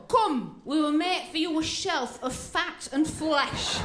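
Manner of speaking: talking stomach. Acted. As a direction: summon them slowly like an eerie host. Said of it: "your stomach's going".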